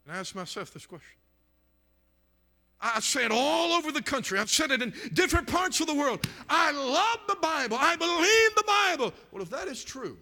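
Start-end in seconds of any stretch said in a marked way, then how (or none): 2.8-9.1 high, loud shouted declaration; fervent revival-preacher shout; full-force preaching. Real, not acted. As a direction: proclaim it at the top of the voice.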